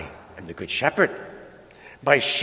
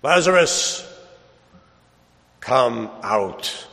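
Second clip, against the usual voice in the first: calling out, commanding voice from outside; voice of Jesus; 3 dB louder, much slower than usual. Acted. Jesus calling Lazarus out of the tomb, commanding call. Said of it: "and I hear the voice".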